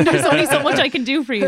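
high, loud, laughing protest; laughing exasperation. Retold or imitated. Teacher recalling students who skipped her tip, laughing exasperation. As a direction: throw it out helplessly through a laugh.

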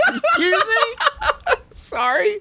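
squeaky high laugh through words; laughing too hard to ask properly. Real, not acted. Laughing at the absurd line.

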